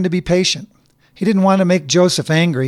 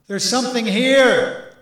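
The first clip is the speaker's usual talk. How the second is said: slow, much higher cry from far above; 8 semitones higher, slower than usual. A narrator's reading. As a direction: call down the discovery. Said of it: "cried a voice from above".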